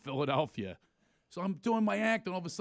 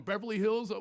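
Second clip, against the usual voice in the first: ordinary talk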